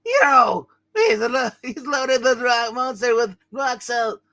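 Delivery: high, loud cartoon cry; pained, high-pitched comic voice; alarmed character yelp. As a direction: yelp it out high as if just hit. Figure hit by rock salt.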